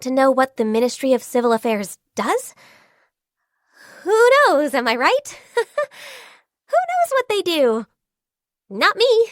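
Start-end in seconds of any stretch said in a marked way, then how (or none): 4.0-9.3 high, giggly shrug-off; joking, laughing. Acted. Joking about the ministry, laughing.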